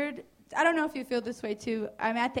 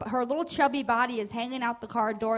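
ordinary talk

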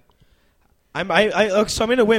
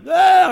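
high, loud "Ah" of horror; acted yell; 11 semitones higher, 9 dB louder than usual. Comic telling of a first talk to a girl, acted yell. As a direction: yelp as if recoiling from her.

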